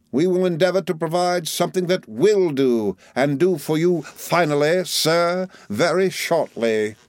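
high, formal, drawn out at the end; lofty Micawber. A narrator's reading. Micawber promises Uriah something that will do, loftily. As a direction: promise it grandly, slowing on the last words.

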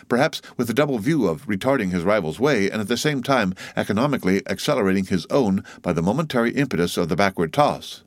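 ordinary delivery, nothing marked

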